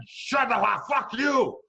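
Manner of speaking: high, loud outburst; explosive shouted insult; angry shouting. Retold or imitated. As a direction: yell it as a heated comeback. Retold shouting match in handcuffs.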